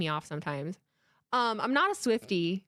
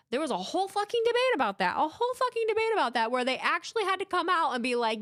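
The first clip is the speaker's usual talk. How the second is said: excited rant; 9 semitones higher than usual. Real, not acted. Ranting about fan debate.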